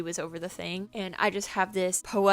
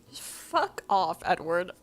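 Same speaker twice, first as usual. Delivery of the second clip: exasperated dismissal; 2 semitones lower than usual. Acted. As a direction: stretch it out, fed up. Repeats dismissal in full voice.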